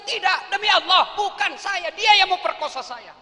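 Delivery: high, loud oath of innocence; high loud desperate denial; loud sworn denial. Acted. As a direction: swear it loudly. Yusuf swears innocence.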